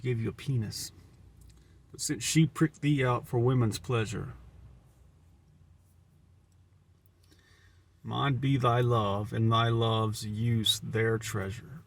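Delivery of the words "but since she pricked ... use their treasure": slow, measured sonnet reading; slow dramatic verse reading; poem recitation. Acted.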